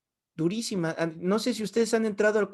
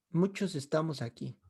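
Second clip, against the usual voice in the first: ordinary talk, nothing marked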